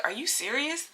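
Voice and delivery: high, hushed disbelief; soft question climbing steeply at the end; retold stunned questioning; incredulous.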